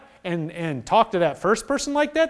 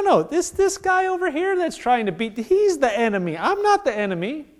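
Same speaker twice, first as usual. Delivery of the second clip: usual speaking voice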